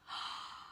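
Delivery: soft, short gasp; shocked gasp. A narrator's reading. Narrator sees the cart roll off, soft shocked gasp. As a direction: draw a quiet breath in shock.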